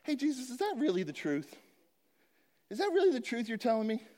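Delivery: high, mock-skeptical asking; doubting questioner. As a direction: play the doubter who can't quite believe it. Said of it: "would you be like".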